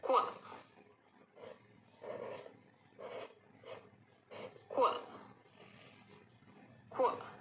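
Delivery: क्व as a K gliding into W; Q sound. Shown as a demonstration. Teacher drills the Q sound क्व. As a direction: clip क्व short. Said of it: "उसके बाद है".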